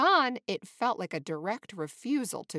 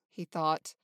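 usual speaking voice

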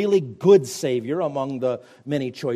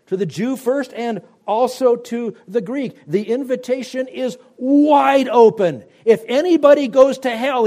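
higher and louder, driving each line home; preaching at full force; 9 semitones higher, 6 dB louder than usual. Acted. Preacher warning the congregation, high and loud. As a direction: build to a fiery peak.

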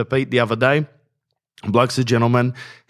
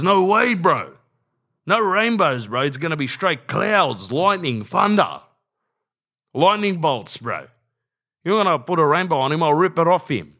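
much higher, hammed-up fired-up bloke; worked-up rant; 8 semitones higher than usual. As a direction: rant like a mate who can't believe it. Brother-in-law's anti-rainbow rant.

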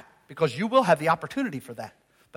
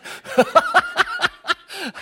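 squealing laugh at his own joke; high burst of laughter. Real, not acted. Preacher laughing at telling people hard truths, high squeal laugh.

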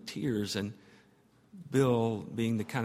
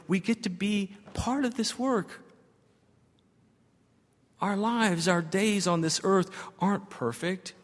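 building sermon preaching; 6 semitones higher than usual. Acted. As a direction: pitch climbs higher as the preacher builds. Preaching climax rising intensity.

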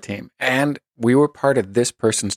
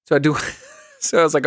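ordinary talk, nothing marked

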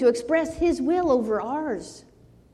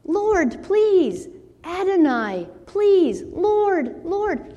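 desperate higher begging, each word falling; pleading; 7 semitones higher, 4 dB louder, much slower than usual. Retold or imitated. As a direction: beg the Lord word by word, pitched high. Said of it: "It says he pleaded".

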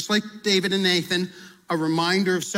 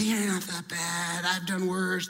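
softer and slow, shrugging it off; dismissive friend; 6 dB quieter, slower than usual. Retold or imitated. Friend waving off a confession, soft and dismissive. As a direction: brush the sin aside like it's nothing.

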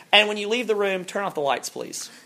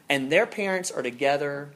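normal talking voice

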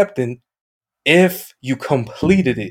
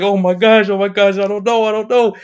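panicked chat; 7 semitones higher than usual. Retold or imitated. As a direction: freak out as the clock runs down. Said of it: "the chat's just like".

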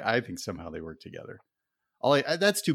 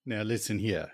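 put-on Southern accent. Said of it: "[name] is dialed in".

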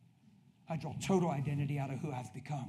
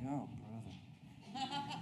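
sarcastic aside; 4 semitones lower, 12 dB quieter, much slower than usual. Retold or imitated. Fake flatterer's inner sneer.

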